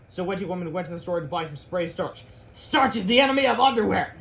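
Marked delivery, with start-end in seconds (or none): 2.5-4.2 high, loud proclamation; hammed-up comic villain; villain's declaration. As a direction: declare it like a scheming supervillain. Villain declares her weapon.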